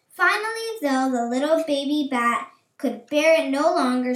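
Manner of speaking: ordinary delivery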